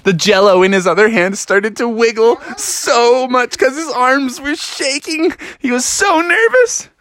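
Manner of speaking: rising to a high, tense pitch; mock-panicked storytelling, ending very high; nervous narration, building. A narrator's reading.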